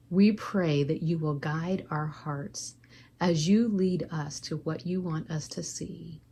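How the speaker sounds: usual speaking voice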